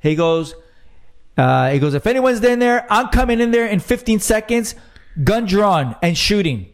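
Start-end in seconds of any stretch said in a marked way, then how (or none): 1.9-6.7 higher, commanding call into the house; mock deputy warning. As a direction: bark the warning like a deputy at the door.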